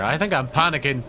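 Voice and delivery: ordinary talk, nothing marked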